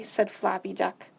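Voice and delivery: ordinary delivery, nothing marked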